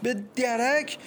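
high, agitated scolding; angry retort. A narrator's reading. Mother snaps back in a quarrel.

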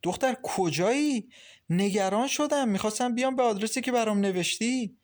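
worried mother scolding her daughter; reproachful. A narrator's reading. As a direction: scold her for coming home late. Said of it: "با شماتت گفت".

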